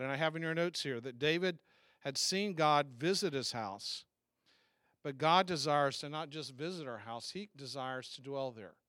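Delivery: ordinary delivery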